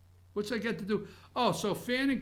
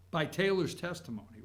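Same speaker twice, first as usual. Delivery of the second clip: ordinary talk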